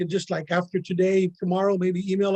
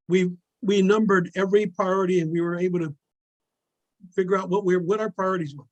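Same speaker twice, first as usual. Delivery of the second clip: ordinary delivery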